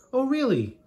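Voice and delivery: high, loud sarcastic "really"; mock him with a big swooping oh really; mocking disbelief. Mike teases James with a loud sarcastic oh really.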